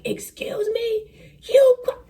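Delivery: high, slow outraged yelp breaking into a laugh; mock outraged passenger. Late passengers cussing out the gate agent, high outraged squeal. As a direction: squeal it like an irate passenger, cracking up. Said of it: "And they're like".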